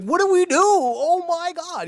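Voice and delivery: high, loud mock panic; hammed-up wrestlers panicking in the ring. Wrestlers in the ring, loud high mock panic. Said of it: "they're all just like".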